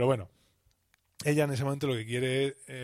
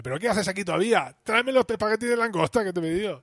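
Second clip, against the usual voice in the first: raised, louder impatient voice; higher, louder impatient customer; impatient demand; 8 semitones higher, 5 dB louder than usual. Retold or imitated. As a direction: bark the order at the waiter, higher. Woman orders lobster pasta, annoyed.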